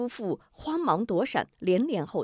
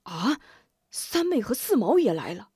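surprised; 3 semitones higher than usual. Acted. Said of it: "又是一惊".

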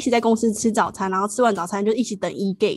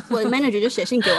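normal talking voice

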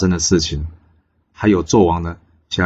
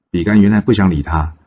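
usual speaking voice

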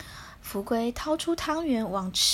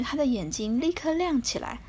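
ordinary delivery

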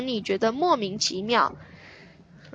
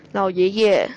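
ordinary delivery

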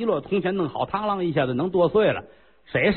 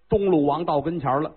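ordinary delivery

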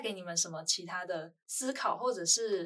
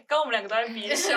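giggling through the words; laughing while talking; 3 semitones higher, 10 dB louder, faster than usual. Real, not acted.

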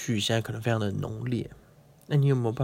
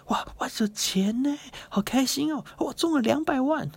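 much higher, squealing with delight; overjoyed winner. Pretend. Imagined Buddha thrilled at winning two million, high squealing joy. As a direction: squeal with joy over the money. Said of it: "佛陀并没有因为".

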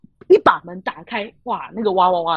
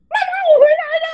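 much higher and louder sing-song call; cheerful surprise call; 5 dB louder, much faster than usual. Retold or imitated. Kid opening parents' door, calling mom brightly. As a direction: burst in calling mom happily. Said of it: "然后打开之后".

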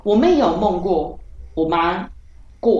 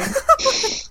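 high burst of laughter; high giggle. Real, not acted. Telling the dream to her friend, high giggle.

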